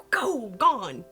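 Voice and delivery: ordinary delivery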